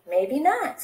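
maybe not lifted at the end on not; carefree goose, rising tone; light shrug. Acted. Goose muses, repeats maybe.

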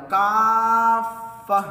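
काफ़ा with the long aa held very long and loud; chanted काफ़ा with held vowel; long madd. Shown as a demonstration. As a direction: stretch the aa in काफ़ा for madd lazim. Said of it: "मद्दे लाजिम का कायदा हो".

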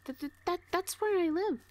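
jumps up high and loud in sudden alarm; startled blurt. Acted. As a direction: blurt it out, caught off guard. Blurts out her home city.

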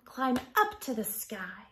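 usual speaking voice

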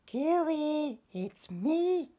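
high, bright little-fish voice calling out; small fish calling to his friends, high and loud. Acted. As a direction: call out cheerfully as tiny Stanley. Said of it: "he called to his friends".

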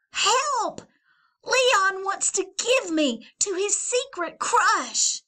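high, loud tattling; cry for help. Acted. Valentine to the boys, loud high squeal. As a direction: squeal for rescue. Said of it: "Valentine crying for help".

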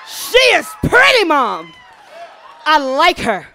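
imitated excited son. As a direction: blurt out a new crush to Mom, loudly. Son excitedly reports new crush.

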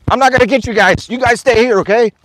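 loud, high-pitched call to the dogs. Real, not acted. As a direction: call out firmly so the dogs stay. Calling out to loose dogs.

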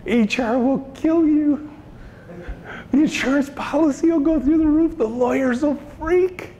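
the fearful voice in your head shouting warnings; dark inner voice. Retold or imitated. The dark voice warning the boss, panicky. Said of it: "The dark voice is like".

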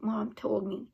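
ordinary delivery, nothing marked

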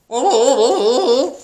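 loud, high, wobbling gobble; turkey gobble. A narrator's reading. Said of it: "gobbled Turkey".

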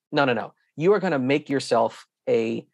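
ordinary talk, nothing marked

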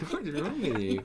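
mock scolding through laughter; laughing teasing. Real, not acted. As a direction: tease him while still laughing.

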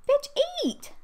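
sudden high, shrill-pitched exasperated yell at the screen; frustrated yell. Real, not acted. Player yelling at her hungry Sim baby to eat, exasperated shout. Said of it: "I should not cuss at my Sims".

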